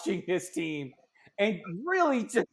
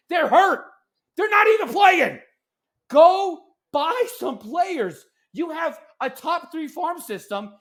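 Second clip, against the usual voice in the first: frustrated outburst; 5 semitones higher than usual. Real, not acted. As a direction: blow up at the team's front office, really fed up. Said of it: "that outburst".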